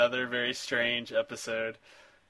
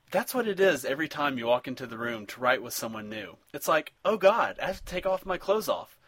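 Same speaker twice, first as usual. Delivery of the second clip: hammed-up recital with swinging, raised pitch; dramatic comment reading; 3 semitones higher, 5 dB louder than usual. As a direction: read spam like an anguished confession, close to laughing. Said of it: "this is the last comment we got on our site".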